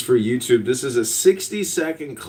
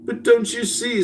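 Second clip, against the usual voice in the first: high, theatrical dream alarm; hammed-up dreaming panic; alarmed sleeper; 10 semitones higher than usual. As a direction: plead like a frightened sleep-talker. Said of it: "said the sleeper".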